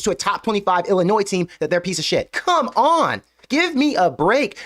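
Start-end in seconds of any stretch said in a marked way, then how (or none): 2.3-4.6 high, drawn-out exasperation; exasperated. Real, not acted. Host fed up with fans overreacting to one loss, exasperated. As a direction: plead with them in exasperation, pitch leaping up.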